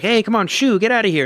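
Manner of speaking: shooing call. Retold or imitated. Neighbours shooing bear from tree.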